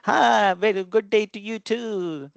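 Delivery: high, put-on American accent mocking an Indian greeting. American friend mimicking an Indian accent. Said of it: "sort of an Indian accent".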